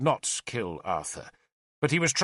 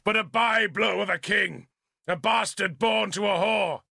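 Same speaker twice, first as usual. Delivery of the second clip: high, indignant shouted retort; shouting; 6 semitones higher than usual. A narrator's reading. Arthur echoing Merlin's insult about himself, shouting. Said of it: "Arthur shouted".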